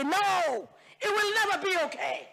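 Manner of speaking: high, pushed declaration; emphatic no. Real, not acted. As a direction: fling it out with conviction. Impassioned denial in parliament.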